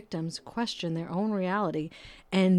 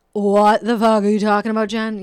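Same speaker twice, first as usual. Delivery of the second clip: imagined listener's outburst; 8 dB louder than usual. Retold or imitated. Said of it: "you're feeling like".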